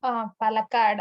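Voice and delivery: ordinary talk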